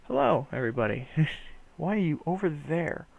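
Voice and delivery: ordinary delivery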